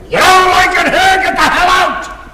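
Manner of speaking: high, loud angry shout; acted-out furious father's outburst. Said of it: "the father shouts".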